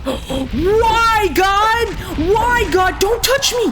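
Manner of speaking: high, loud screaming plea at the ghost; panicked scream. Real, not acted. Player screaming as the ghost attacks, panicked. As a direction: shriek it out in real panic.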